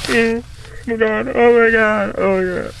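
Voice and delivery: very high, mock squealing panic; badly acted horror. Daughter watching mom get stabbed, badly acted horror. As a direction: imitate a bad actress being horrified. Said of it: "You are such a bad actress".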